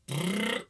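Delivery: buzzing lip trill. Shown as a demonstration. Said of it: "or this lip trill".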